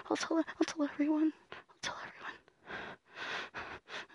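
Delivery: fading threat. Acted. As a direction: let the threat die into a whisper.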